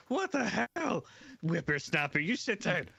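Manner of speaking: a high offended yelp then scolding; an offended old partner scolding the rookie. Acted. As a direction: scold the cheeky youngster.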